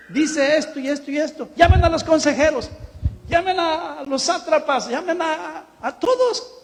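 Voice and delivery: rey agitado que da órdenes en voz más aguda; rey dando órdenes. Retold or imitated. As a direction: ordena como un rey desesperado. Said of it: "Dijo el rey". (un rey alarmado que llama a todos)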